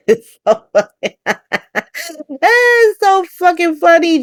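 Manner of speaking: can barely talk for laughing. Real, not acted. Laughing at Ava's new status.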